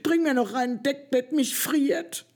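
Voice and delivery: shivering call. A narrator's reading. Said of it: "klappert mit den Zähnen und ruft".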